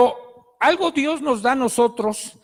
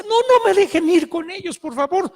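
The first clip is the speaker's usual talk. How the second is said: high, loud desperate plea, easing at the end; imitated distraught begging, pitched way up; anguished pleading; 9 semitones higher, 4 dB louder than usual. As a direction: act out a frantic girl begging. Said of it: "hecha un mar de lágrimas y angustiadísima".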